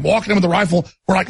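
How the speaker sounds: normal talking voice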